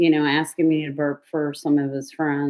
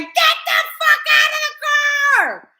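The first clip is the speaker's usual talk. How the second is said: much higher, louder mock shout; retold shout; 6 dB louder than usual. Him shouting at the woman from the passenger seat, mock shout. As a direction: bellow it, hammed up. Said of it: "I was like".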